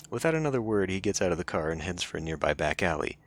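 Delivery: ordinary talk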